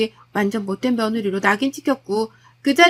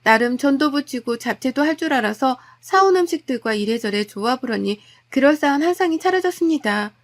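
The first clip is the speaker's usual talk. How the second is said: usual speaking voice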